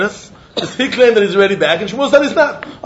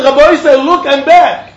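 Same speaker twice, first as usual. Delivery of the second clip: higher, louder calling to the rabbi; retold call; 5 semitones higher, 4 dB louder than usual. Man calling to the rabbi that he's back, raised call. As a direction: call out look I'm back. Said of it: "And he said".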